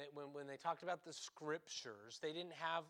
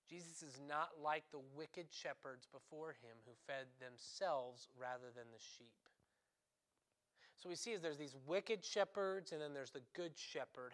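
ordinary talk

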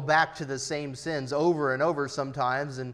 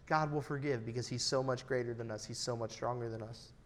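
ordinary talk, nothing marked

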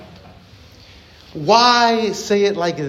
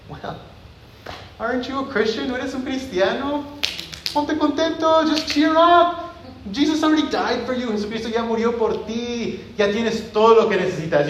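higher-pitched, sing-song mock encouragement; glib cheering up; 4 semitones higher than usual. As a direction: brush off a sad friend with chirpy platitudes. Glib believer cheering someone up.

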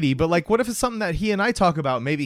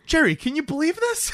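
high-pitched, exasperated sitcom whine; George Costanza; 12 semitones higher than usual. Acted. Neurotic friend complaining to his buddy, high exasperated impression. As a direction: complain up high in comic disbelief. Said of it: "He's George Costanza".

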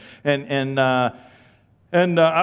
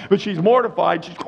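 usual speaking voice

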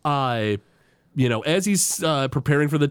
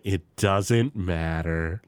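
low and slow, a bit deflated; imitating Homer, understated gloom; mock glum reply; 9 semitones lower, 3 dB quieter, much slower than usual. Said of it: "And he's like".

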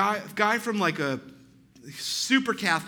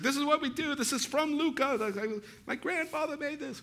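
much higher, softer proud Italian host; 9 semitones higher, 4 dB quieter than usual. Retold or imitated. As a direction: offer the wine with family pride. Neighbor proudly pours wine.

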